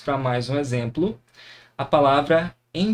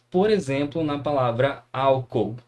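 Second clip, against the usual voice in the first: ordinary talk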